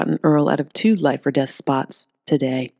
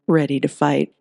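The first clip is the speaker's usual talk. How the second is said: ordinary delivery, nothing marked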